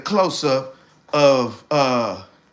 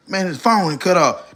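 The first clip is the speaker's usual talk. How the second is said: ordinary talk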